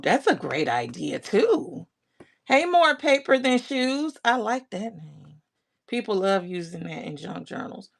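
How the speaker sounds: ordinary talk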